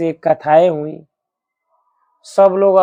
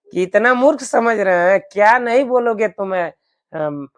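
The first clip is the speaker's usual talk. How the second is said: ordinary delivery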